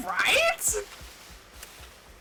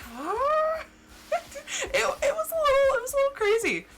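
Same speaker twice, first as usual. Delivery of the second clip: laughing; 14 semitones higher than usual. Real, not acted. Laughing at the confusing story.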